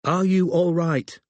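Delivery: a lightly voiced anxious mum on the phone, quick; fretful mother checking in; mother's worried call. A narrator's reading. Mum asking her daughter on the phone, lightly anxious.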